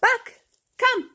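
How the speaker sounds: very high call to the dog far off. Real, not acted. Trainer calling Buck in from the yard, high ringing call. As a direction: sing out his name high.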